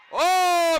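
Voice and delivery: sudden loud cry of "ओ"; a short excited yell as the throw lands; excited shout. Real, not acted. Commentator to crowd as the wrestler throws, excited yell.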